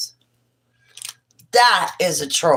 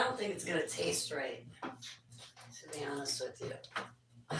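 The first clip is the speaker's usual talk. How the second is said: whisper; 19 dB quieter, slower than usual. Real, not acted. Whispered aside.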